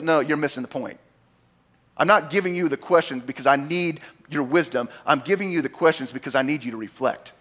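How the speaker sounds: low, soft voice of God correcting; quiet correction. Retold or imitated.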